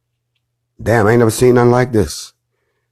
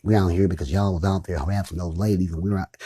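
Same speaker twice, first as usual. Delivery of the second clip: put-on light Louisiana accent, lower and softer; imitating a Louisiana accent; 3 semitones lower, 10 dB quieter than usual. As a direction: quote the Louisiana kid in his accent. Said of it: "I love his accent".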